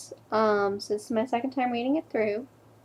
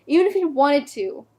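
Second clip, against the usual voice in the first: insistent outburst; 2 semitones higher, 6 dB louder than usual. Acted. Girlfriend denying boyfriend's suicide, loud insistent outburst. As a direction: burst out as if refusing to accept it. Repeated line bursts out, insisting.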